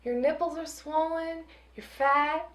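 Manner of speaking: hammed-up baby talk to a pregnant dog; mock cooing. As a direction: coo at the dog like a fussing owner. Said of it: "She leans over and goes".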